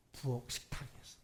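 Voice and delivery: hushed, breathy whisper of 부엌 식탁에서. Shown as a demonstration. Pastor to congregation, whispering 부엌 식탁에서. Repeated detail, first whispered.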